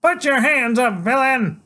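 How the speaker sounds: high, loud, slow hero's command; a kid playing hero shouting at the villain; small faraway voice. Boy hero ordering the villain, high and loud. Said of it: "faraway voice is saying".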